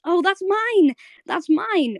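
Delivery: high, flustered claim, imitating a character; embarrassed interjection. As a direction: blurt it out high, acting embarrassed. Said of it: "really embarrassed".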